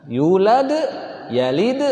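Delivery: "Yulad" and "yalid" stopped on a bouncing d; silent final vowel. Shown as a demonstration. Teacher showing vowelless endings, "Yulad, yalid" stopped on d. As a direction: say each word alone, closing on d, rising. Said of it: "Itu mati".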